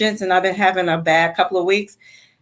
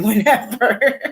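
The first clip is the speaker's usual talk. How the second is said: amused, breaking into a laugh; 4 semitones higher, slower than usual. Real, not acted. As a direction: laugh through the word. Jokes about lockdown meditation, laughs.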